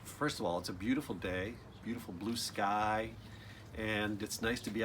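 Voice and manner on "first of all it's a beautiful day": ordinary delivery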